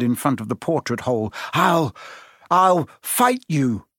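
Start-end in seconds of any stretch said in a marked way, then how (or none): 1.5-3.9 higher, halting threat; faltering defiance. A narrator's reading. Neville squares up to his friends, falteringly defiant. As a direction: threaten them slowly, faltering at the start. Timid boy threatens to fight.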